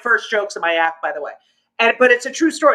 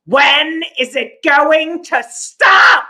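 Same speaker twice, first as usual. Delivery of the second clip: loud, slow, drawn-out mock yell; mother's exasperated yell; 3 semitones higher, 5 dB louder, much slower than usual. Mother to the doctor about daughter's height, exasperated mock yell. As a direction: stretch it out and explode on STOP.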